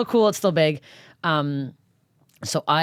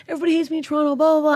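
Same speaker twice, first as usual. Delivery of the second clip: high, louder whining complaint; whiny self-mockery; 13 semitones higher, 4 dB louder, much faster than usual. Comic mocking her self-pity before moving, whiny. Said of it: "I'm like".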